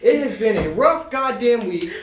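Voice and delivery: loud, fed-up outburst; yelling. Real, not acted. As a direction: raise your voice, exasperated. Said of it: "Why are you yelling?".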